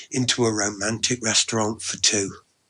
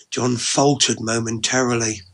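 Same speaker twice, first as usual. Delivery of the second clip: ordinary delivery, nothing marked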